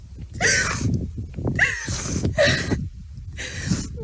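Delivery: long high unvoiced sob; a shaky, breathless sob. Real, not acted. Overcome after quoting Jesus, sobbing.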